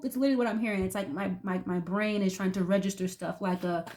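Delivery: ordinary talk, nothing marked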